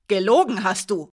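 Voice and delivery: loud, sharp accusing cry; loud, furious "Gelogen hast du!"; angry outburst. A narrator's reading. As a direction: throw the accusation at her. Alina flares up at Hiraya.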